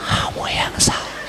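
whispered scolding, soft and hushed; angry whisper. Retold or imitated. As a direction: scold in a whisper so the kids can't hear. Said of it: "kalau marah harus bisik-bisik".

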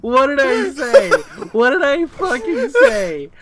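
laughing told-you-so. Real, not acted. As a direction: talk through laughter.

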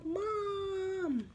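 put-on calling for mom like a small child; whiny little llama. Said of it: "He's like".

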